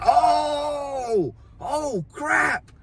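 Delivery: high, drawn-out mock yell; imitated yelling. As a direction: hoot a long, high "oh" in mock alarm. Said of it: "He's yelling loud as crap".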